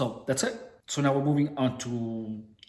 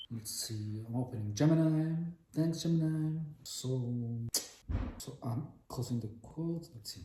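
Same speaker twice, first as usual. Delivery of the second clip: quiet muttering; 3 semitones lower, 11 dB quieter than usual. Real, not acted. Muttering while using app.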